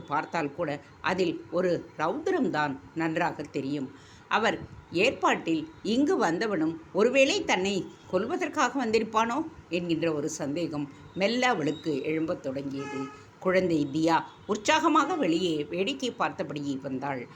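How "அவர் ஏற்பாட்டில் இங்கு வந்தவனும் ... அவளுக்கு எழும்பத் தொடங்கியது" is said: ordinary delivery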